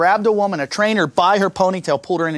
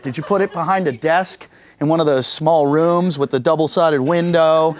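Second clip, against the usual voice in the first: ordinary delivery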